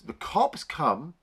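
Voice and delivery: ordinary talk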